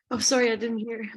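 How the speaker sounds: normal talking voice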